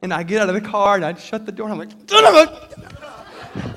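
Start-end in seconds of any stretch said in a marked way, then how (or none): 2.1-3.7 high, loud, stretched-out mock curse; hammed-up frustrated yell; livid outburst. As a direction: act out exploding in fury, then cut off. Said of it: "absolutely mad and livid with myself".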